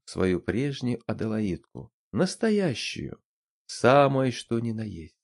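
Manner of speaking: ordinary delivery, nothing marked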